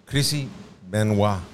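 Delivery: Benoit said the French way, "bɛ̃nwɑ" with a nasal vowel and a silent t; "Benoit" with a hammy French nasal ending; mock French accent. Shown as a demonstration. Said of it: "Mira esto".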